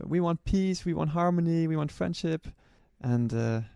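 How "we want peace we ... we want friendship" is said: mock shouting. Retelling schoolkids greeting the runners, mild higher-pitched mock shout. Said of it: "shouting like".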